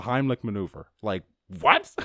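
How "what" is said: high loud shocked squeal. Retold or imitated. Friends react to Heimlich with high loud shocked squeal. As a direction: shriek it in disbelief.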